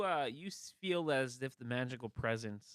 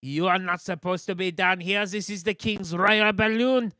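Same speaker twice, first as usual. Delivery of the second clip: guard voice; 10 semitones higher, 16 dB louder than usual. Acted. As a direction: bellow like a pompous royal guard.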